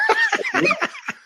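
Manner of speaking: high sudden laugh; burst of laughter. Real, not acted. [name], recalling being called broke, bursting into laughter. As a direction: let out a surprised laugh. Reacts to the insult.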